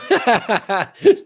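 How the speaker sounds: high, bubbling laugh. Real, not acted. Host teasing a viewer about staying on the phone, laughing. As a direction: burst out in a high giggle.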